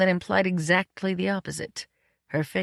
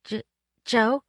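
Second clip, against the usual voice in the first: hesitant; 5 semitones higher than usual. A narrator's reading. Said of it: "approached me hesitantly".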